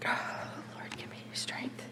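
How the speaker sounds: a breathy whisper to God; hushed plea for strength; whispered prayer. Acted. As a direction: pray it under your breath.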